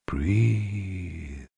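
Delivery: soft, distant otherworldly voice; haunting voice. Acted. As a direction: call from far away, hollow. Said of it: "the haunting voice".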